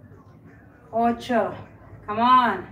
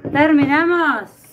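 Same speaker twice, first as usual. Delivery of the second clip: excited; 5 semitones higher, 7 dB louder, slower than usual. Real, not acted. Announcing the workout is done.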